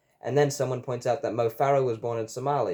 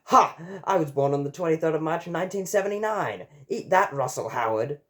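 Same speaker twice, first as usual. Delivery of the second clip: triumphant exclamation; 5 semitones higher than usual. A narrator's reading. James exclaims in triumph at Russell Howard, gloating. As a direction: crow it out as a victory. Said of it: "James exclaims".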